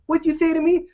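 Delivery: high, challenging mock threat; a hammed-up touchy boxer; mock menace. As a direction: jump on the greeting like an offended Tyson. Imagined Tyson snapping at greeter.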